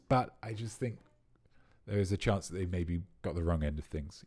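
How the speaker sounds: usual speaking voice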